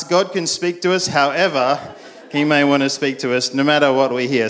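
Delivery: ordinary delivery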